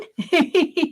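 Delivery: light, quick giggle; amused little tee-hee. Real, not acted. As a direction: giggle to yourself in short bursts. Amused by a laughing viewer.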